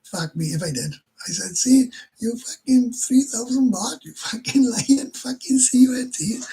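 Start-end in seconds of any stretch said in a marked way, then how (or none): 1.6-6.4 higher, louder, slow furious yell; angry shout. Retold or imitated. Retelling how he yells at the lying bar girl, slow and furious. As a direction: let rip at the lying girl, slow and loud.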